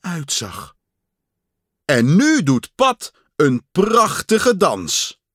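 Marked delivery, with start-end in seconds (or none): 1.8-5.2 high, loud, slow stage announcement; stretched showman's introduction; announcer voice. A narrator's reading. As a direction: present the act with grandeur. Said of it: "zei de stem".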